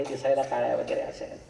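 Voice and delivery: normal talking voice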